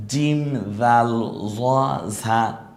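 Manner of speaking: জিম, জাল, জ, ঝা each drawn out very slowly; slow letter drill. Shown as a demonstration. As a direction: separate the four letters clearly. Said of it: "আমার মতো করে বলেন". (ধীরে আলাদা করে চার হরফ উচ্চারণ)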